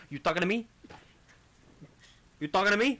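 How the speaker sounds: a Brooklyn wiseguy squaring up; Brooklyn accent. Acted. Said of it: "Thormir goes to his Brooklyn accent".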